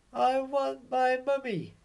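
very high, small voice wailing for mummy; whiny baby owl. Acted. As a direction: play a tiny owlet who misses his mum. Baby owl Bill cries for mum.

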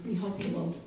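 ordinary delivery

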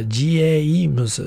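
"G A E M S" sounded out as ji-e-i-mu-su; misconverted katakana James. Shown as a demonstration. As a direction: split "G A E M S" into separate syllables, ji first. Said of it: "it actually turns it into a".

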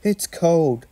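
high, plaintive shiver; acted chilly wail. A narrator's reading. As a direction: complain of the cold in a small high voice. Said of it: "shivered Percy".

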